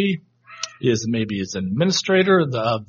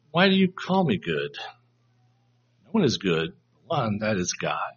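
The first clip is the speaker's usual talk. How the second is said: gentle, thought-provoking question; 5 semitones lower, 5 dB quieter than usual. Shown as a demonstration. Jesus prompting the ruler to think, gently questioning. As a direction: ask it quietly to make him think, no reproof. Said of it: "it's not a rebuke anymore".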